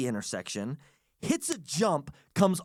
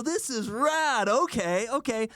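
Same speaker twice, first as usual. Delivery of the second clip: excited; 8 semitones higher, slower than usual. Retold or imitated. Thrilled at the Tron film set.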